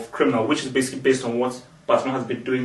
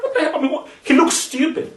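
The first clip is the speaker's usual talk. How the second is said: baffled; 13 semitones higher than usual. Pretend. Imagined reaction to Batman.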